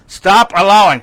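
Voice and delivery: loud, urgent admonition; emphatic command. Acted. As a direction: push it out high and forceful. Preaching the verse's command.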